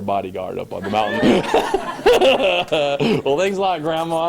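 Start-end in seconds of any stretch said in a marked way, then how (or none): 1.5-3.2 loud high laugh. Real, not acted. Laughing at grandma's offer. 3.2-4.3 amused thanks. Retold or imitated. Joking reply to old lady.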